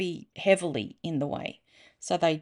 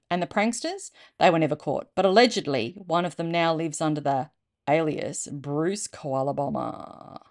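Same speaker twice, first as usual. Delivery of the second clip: ordinary delivery, nothing marked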